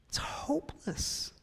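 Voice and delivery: slow, hushed whisper of despair. Real, not acted. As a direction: let it fall to a slow whisper. Sermon on hopelessness, hushed aside.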